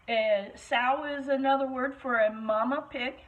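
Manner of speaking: ordinary delivery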